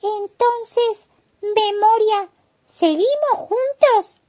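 vocecita muy aguda de caracol; caracol curioso. Acted. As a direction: pregunta con voz de caracolito, aguda y lenta. El caracol pregunta a la tortuga. (caracol curioso, con voz muy aguda)

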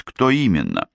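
ordinary delivery, nothing marked

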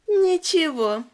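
put-on high, slow, gloating little demon girl; creepy demon girl. A narrator's reading. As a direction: savor the words like a hungry child demon.

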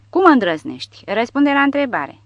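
enraged officer yelling at Porta; furious shout. A narrator's reading. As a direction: blast him for his insolence.

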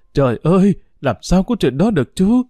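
a mother crying out in disbelief at a death. A narrator's reading. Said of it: "la lên".

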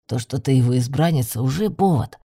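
low-pitched familiar voice; deep-voiced magical companion; male familiar. Familiar argues with heroine.

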